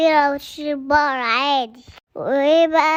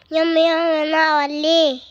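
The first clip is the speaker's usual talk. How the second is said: normal talking voice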